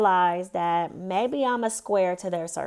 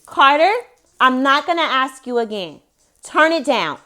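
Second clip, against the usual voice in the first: stern shout; 5 semitones higher, 8 dB louder than usual. Real, not acted. Scolding child to turn down.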